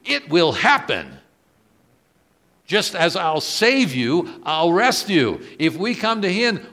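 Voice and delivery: high, loud, slow impassioned promise; preaching at full force. Acted. Preacher proclaiming God's promise, loud and high. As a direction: lift the whole room with certainty.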